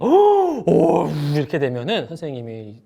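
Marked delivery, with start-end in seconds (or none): 0.1-1.1 sharp high gasp of shock; startled gasp. Acted. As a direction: gasp as if the name stuns you. Shocked reaction to a customer's name.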